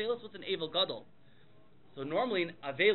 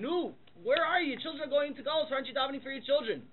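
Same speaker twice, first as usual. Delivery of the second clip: higher-pitched, acted urging; urgent reproach; 7 semitones higher than usual. Prophet urging the forefathers to pray for their children, urgent reproach. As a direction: plead with them in a raised pitch.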